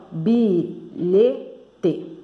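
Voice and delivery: bilhete broken into bi... lhe... te; syllable by syllable. Shown as a demonstration. Teacher showing children the syllables of a word, bilhete said separated. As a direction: say bilhete slowly with gaps between syllables. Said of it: "Tô falando separando".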